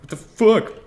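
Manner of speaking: sudden high, loud what-the-fuck; startled outburst. Real, not acted. Gamer startled by a pipe, sudden high shocked shout. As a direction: blurt it out, caught off guard.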